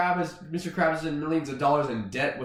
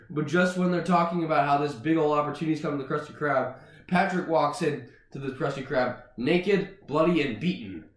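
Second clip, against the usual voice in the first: ordinary talk, nothing marked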